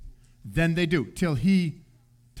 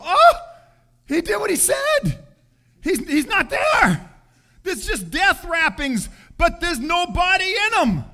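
high, loud astonished cry at the empty tomb; amazed discovery; 9 semitones higher, 4 dB louder than usual. Retold or imitated. Women at the empty tomb, shouting in amazement. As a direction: burst out in disbelief.

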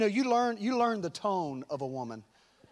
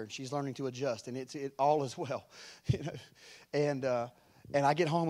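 normal talking voice

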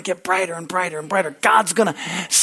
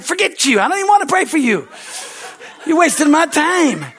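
high, raised mock rant; retold exasperated outburst; 10 semitones higher, 5 dB louder than usual. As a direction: act out being fed up with someone. Acts out his exasperation with her.

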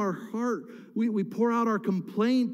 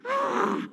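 short high cry of exasperation; frustrated scream. Acted. Caller never called back, exasperated cry. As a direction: let out a high "ah".

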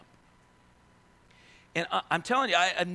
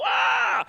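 startled cry; 7 dB louder than usual. Retold or imitated. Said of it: "fall back and kinda sit on my butt and go".

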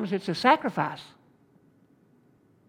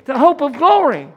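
full-force preaching; 3 semitones higher than usual. Acted. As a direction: preach it out, urging them not to quit. Preaching to congregation.

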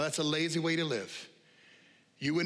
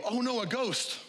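mock fright; 8 semitones higher than usual. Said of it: "where they go".

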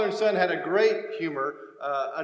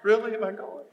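ordinary talk

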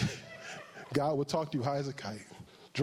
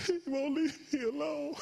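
high wailing sobbing voice with laughter, mock crying; crying caller; 11 semitones higher than usual. Pastor imitating a crying caller on the phone, high mock sobbing. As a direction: wail it like a crying baby. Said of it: "crying his eyes out".

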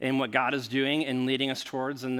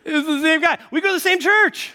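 very high, loud comic exclamation; squealed amazement at the coincidence; incredulous punchline; 13 semitones higher, 8 dB louder than usual. Acted. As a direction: shout in laughing disbelief. Repeats climax over laughter.

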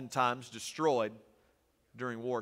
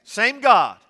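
sudden high, loud cry of two words; shouted proclamation; preaching shout; 9 semitones higher, 14 dB louder than usual. Real, not acted. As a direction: hit it hard from the pulpit. Preacher hammering a point home.

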